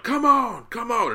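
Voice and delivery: high-pitched imitation of a rallying cry; fired-up wrestler. Podcaster acting out the wrestler getting fired up, high excited cry. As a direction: mimic the wrestler's fired-up plea, voice pushed high. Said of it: "he gets all fired up".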